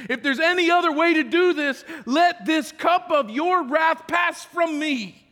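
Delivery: loud, rising plea to the Father; pleading prayer. Acted. Preacher voicing Jesus begging God in the garden, loud plea.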